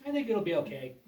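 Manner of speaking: normal talking voice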